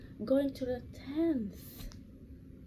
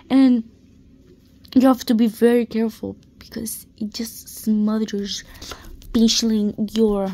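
emphatic loud; 12 dB louder than usual. Real, not acted. Emphatic violin complaint, loud.

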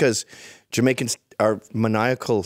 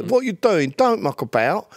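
high-pitched Cockney impression; East London accent; 9 semitones higher than usual. Guest imitates an old East London guy, Cockney accent. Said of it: "like East London".

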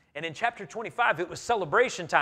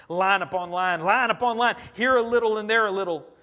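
forceful proclamation; 3 semitones higher, 9 dB louder than usual. Acted. Repeats scripture phrase, forceful.

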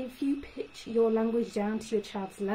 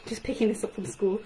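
usual speaking voice

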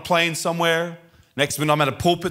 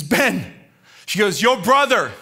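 raised, urgent cry; imitated screaming mother; 5 semitones higher, 4 dB louder than usual. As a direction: call it out like a distraught mother. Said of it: "She's screaming".

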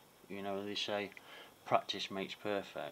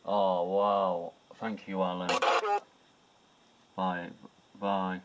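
excited; 10 dB louder, slower than usual. Real, not acted. Thrilled thanks to spirit Alan.

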